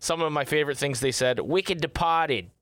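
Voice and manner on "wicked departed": put-on slow, drawn-out Boston accent on "Wicked Departed"; mock the Boston brothers' accent. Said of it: "this super obnoxious Boston accent".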